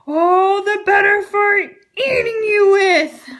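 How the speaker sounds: the wolf pounces, roaring. Acted. Wolf reveals himself.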